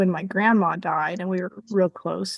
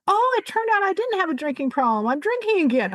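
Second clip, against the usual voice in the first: high-pitched, blithe announcement, imitating a friend; breezy mock confession; 11 semitones higher than usual. Friend on the phone admits relapse, breezy mock confession. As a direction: toss it off high and carefree, as a parody.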